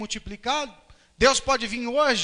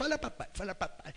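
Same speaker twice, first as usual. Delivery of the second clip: softer, rapid-fire repeated coaxing; eager father coaxing; 2 semitones lower, 13 dB quieter than usual. Acted. Father nagging his newborn to say papai, soft coaxing. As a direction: urge a newborn over and over. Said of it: "imagina um pai que o neném acabou de nascer".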